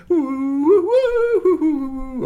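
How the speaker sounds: high, loud singsong hoots; swooping, piercing musical call; sung wooing. Acted. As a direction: sing out woo from a doorway. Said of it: "Shui Huang sang from the doorway".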